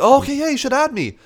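high, eager mock voice of a pushy older guy; Ernie's eager voice. Mocking the old photographer as he asks to be added, high and eager. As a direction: play him overly keen to connect.

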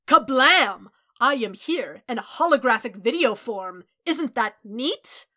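booming, theatrical hero announcer; very loud showman with big swooping pitch; All Might. A narrator's reading. Hero greets a student by hologram, loud and bombastic.

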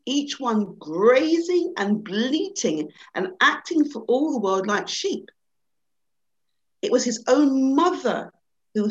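lively storybook reading with rising excitement; animated storyteller voice; story narration. A narrator's reading. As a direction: read it like a bedtime fable to an audience. Reads a children's fable aloud.

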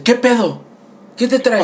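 hammed-up who-do-you-think-you-are; mock challenge. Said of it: "se voltea y me dice". (reto burlón, voz más aguda)

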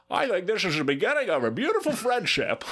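high, hammed-up movie-line voice; goofy high-pitched character; put-on character voice. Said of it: "Find another voice".

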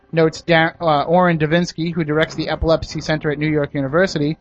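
usual speaking voice

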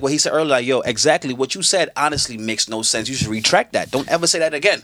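low, controlled telling-off; firm but contained, pitched low; measured rebuke. Acted. Showing a co-host how to rebuke someone at work, measured rebuke.

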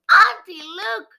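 high, loud call to her aunt; crying out. Acted. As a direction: call out sharply in alarm. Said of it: "she cried".

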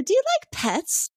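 high, slow syrupy ask; lightly mock-sweet lure; a sly hostess baiting her guest; sugary question.

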